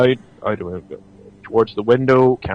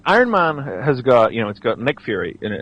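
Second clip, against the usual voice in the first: usual speaking voice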